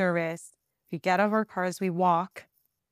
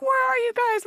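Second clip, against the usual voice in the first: much higher panicked shout to lost friends; alarmed call. Retold or imitated. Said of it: "And they're like".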